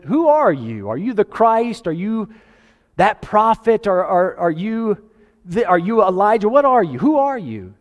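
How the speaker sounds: high-pitched, insistent questioning; pressing interrogation. Acted. Acting questioners pressing John the Baptist.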